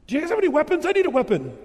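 a general bursting in, frantically asking for weapons; frantic demand. Retold or imitated. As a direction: blurt it out like a panicked soldier.

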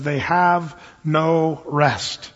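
slow, deliberate scripture quote; reading the margin note, slowly. Shown as a demonstration. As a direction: lay out each word, slowly. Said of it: "it says".

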